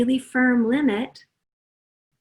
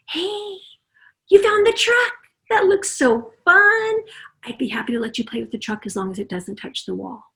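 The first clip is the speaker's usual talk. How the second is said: nice calm limit; 4 semitones higher than usual. Shown as a demonstration. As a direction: sound pleased, then set the limit gently. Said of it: "where you were nice and calm".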